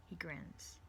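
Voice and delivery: plain reading voice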